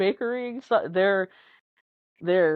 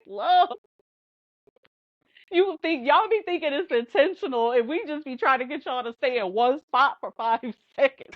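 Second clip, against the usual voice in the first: higher, pleading exasperation; exasperated explaining; 7 semitones higher than usual. Retold or imitated. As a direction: plead with a fed-up laugh. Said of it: "I'm like".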